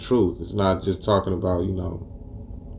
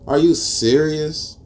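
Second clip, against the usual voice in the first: disgusted; 6 semitones higher than usual. Retold or imitated. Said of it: "I'm like".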